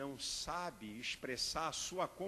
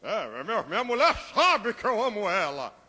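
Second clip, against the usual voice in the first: 1 smug husband; 3 semitones higher, 11 dB louder than usual. Retold or imitated. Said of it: "husband boasting about wife".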